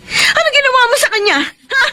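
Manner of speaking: high, tearful panic; panicked crying. Acted. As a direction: cry out in fear for the child. Mother hears child is dead. (umiiyak sa takot at pagkataranta)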